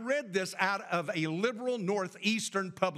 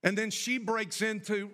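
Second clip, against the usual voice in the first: ordinary delivery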